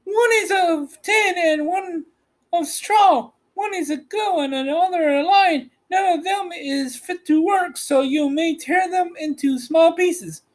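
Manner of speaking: high witch voice, scornful; wicked witch voice. A narrator's reading. The Witch dismissing the travellers to the wolf leader, high witch voice. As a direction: sneer down at them in a high witch voice. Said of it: "she answered".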